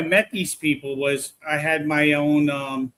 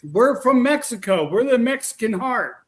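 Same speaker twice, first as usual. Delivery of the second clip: raised, louder distant-spirit declaration; imitated spirit voices; 7 semitones higher, 4 dB louder than usual. Investigator mimicking spirits heard in the distance, raised call. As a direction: call it out high like voices from afar. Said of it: "Exactly what they said".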